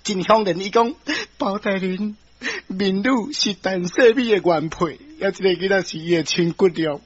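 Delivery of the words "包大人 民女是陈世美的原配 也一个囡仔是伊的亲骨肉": lightly voiced plaintive woman; wronged wife pleading. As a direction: plead your case humbly before the judge. Wronged wife explains to judge. (苦情民女哀訴的口氣)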